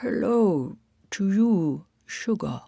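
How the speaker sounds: slow, drawn-out greeting; lightly voiced serene guru; dreamy Marcy.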